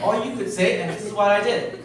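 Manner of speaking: normal talking voice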